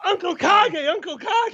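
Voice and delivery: very high, excited calling; mock-frantic squeal of a call; Kage. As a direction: push the name way up high. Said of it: "our favorite impersonation of Kage".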